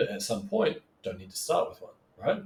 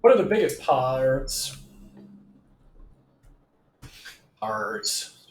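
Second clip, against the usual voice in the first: slow growly pirate drawl; accidental pirate voice; 3 dB louder, much slower than usual. Real, not acted. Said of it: "why I sound like a pirate then".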